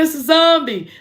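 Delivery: scared insistence. Retold or imitated. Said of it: "I said".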